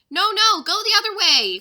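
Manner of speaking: loud alarmed shout at the elephant; cry out. Acted. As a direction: panic as she turns wrong. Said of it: "cried Jack".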